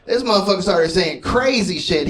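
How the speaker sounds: ordinary talk, nothing marked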